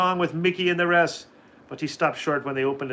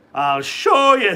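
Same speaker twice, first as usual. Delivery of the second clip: slow, louder menacing promise; slow threat; 5 semitones higher, 8 dB louder, slower than usual. A narrator's reading. Leprechaun Patrick warns the cocky duck, slow louder threat. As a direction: warn him slowly. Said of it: "said Patrick".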